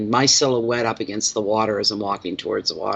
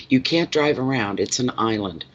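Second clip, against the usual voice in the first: ordinary delivery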